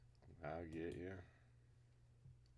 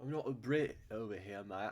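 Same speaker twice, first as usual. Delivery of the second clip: put-on a quick jokey British accent, a bit louder; mock British accent; 3 semitones higher, 6 dB louder than usual. Player mocking the metric system in a British accent. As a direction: toss off mate like a hammed-up Brit. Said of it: "I'm not a fucking British person".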